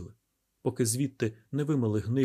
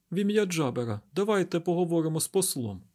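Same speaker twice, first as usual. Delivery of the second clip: high-pitched, insistent character plea; pitched-up dialogue voice for a woman; woman character's voice; 6 semitones higher than usual. A narrator's reading. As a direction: urge it in a raised, higher voice. Narrator voices Stemfulher in dialogue.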